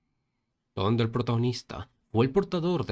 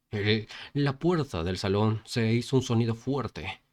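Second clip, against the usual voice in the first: ordinary delivery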